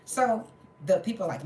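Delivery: ordinary delivery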